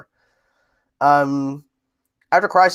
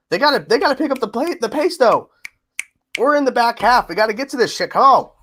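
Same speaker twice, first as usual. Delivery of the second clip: impatient; 8 semitones higher, 4 dB louder than usual. Real, not acted. Impatient with the show's pace.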